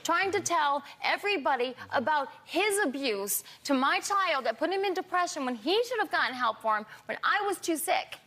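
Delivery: ordinary talk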